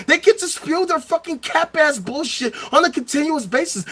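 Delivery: usual speaking voice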